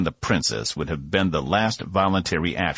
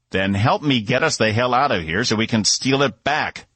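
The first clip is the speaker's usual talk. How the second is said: higher, louder urgent yell; 7 semitones higher, 4 dB louder than usual. A narrator's reading. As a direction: push it out in a hurry and anger. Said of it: "Cass yelled".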